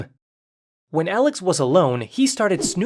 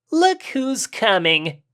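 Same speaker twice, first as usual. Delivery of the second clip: slow, higher mock sneer; 5 semitones higher, much slower than usual. Skinny bully taunts the boy, slow and mocking. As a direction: drag it out to taunt him. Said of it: "said the skinny one in a mocking tone".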